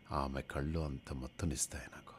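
soft whispered swoon; dreamy whisper. Acted. Patient tells the doctor her eyes intoxicate him, whispering. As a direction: breathe it out, lost in love.

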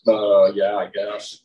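low, offhand and modest; sheepish shrug. Retold or imitated. To the thanking recipient, an awkward low shrug. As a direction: play it down, awkward.

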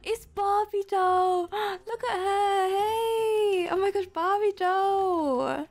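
high, stretched-out delighted squeal; thrilled high cry, words drawn out; excited squeal. Real, not acted. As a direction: squeal at spotting someone you adore. Spots a fan she was searching for.

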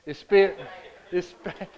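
laughing as he settles it; spit, said through a laugh; laughing at his own word mix-up. Real, not acted. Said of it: "Spit or spat or spit?".

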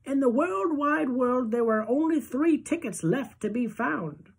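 put-on high, thin old-lady voice; old Grandma Josephine consoling Charlie, high and thin; grandmother voice. A narrator's reading.

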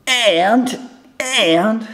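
high, dragged-out sign-off; mock YouTuber outro voice; imitating Peter. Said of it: "the way Peter ends his, uh, videos with".

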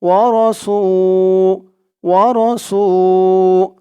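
slow "ওয়া র সু" with a long held "সু"; one-alif elongation. Shown as a demonstration. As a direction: draw the u of "সু" out evenly. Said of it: "সু এক আলিফ টান".

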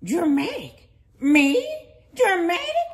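affronted, rising on the last word; punchy, offended questioning; indignant disbelief. Acted. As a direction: throw the accusation back, scandalized. Accused of being dramatic.

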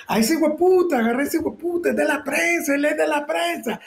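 shouting boss. Retold or imitated. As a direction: bark the order across the street. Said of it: "el jefe le dice del otro lado del bulevar". (grito del jefe a lo lejos)

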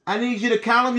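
ordinary talk

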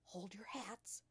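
hushed warning whisper. A narrator's reading. Hortensia warns her friends as trouble starts, whispering. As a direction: whisper it under your breath. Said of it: "Hortensia whispered".

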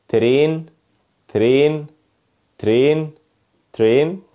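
"ترين" with a plain t, then r; "ترين" without any "ch" sound; wrong TR pronunciation. Shown as a demonstration. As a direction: say "ترين" as "te-rain", learner's mistake. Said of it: "يلا نبدأ مع اول حاجة وهي النطق الغلط".

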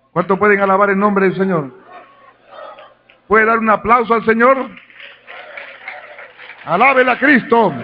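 loud, high call to praise; rally the church to praise, loud; full-force preaching. Acted. Pastor calling the church to praise, loud and high.

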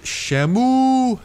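Shamu with a long, high, loud "ooh"; exaggerated pronunciation. Shown as a demonstration. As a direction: stretch the end of Shamu way up high. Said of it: "That is how you pronounce it".

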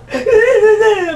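loud squealing laugh; high laugh. Real, not acted. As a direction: burst of high-pitched laughter. Laughs after mocking.

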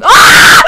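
sharp frightened yell; scream of fright. Real, not acted. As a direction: scream at jump scare. Sudden scare.

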